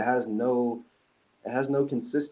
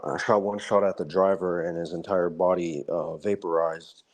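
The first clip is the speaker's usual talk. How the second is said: low, matter-of-fact retelling, unbothered by the gore; calm ex-soldier; 4 semitones lower than usual. Retold or imitated. Ex-soldier recounting a gun running mission, calm and lower. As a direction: imitate a veteran calmly recounting his kills. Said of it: "always so calm when he's talking about it".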